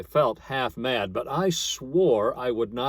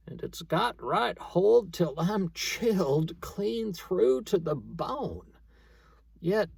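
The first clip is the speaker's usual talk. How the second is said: higher, slow moan of a freezing man; 6 semitones higher, slower than usual. Acted. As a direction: moan out the dread of the icy grave. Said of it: "with sort of a moan".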